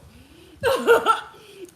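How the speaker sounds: high, loud burst of laughter. Real, not acted. Laughing at her friend's fabulous.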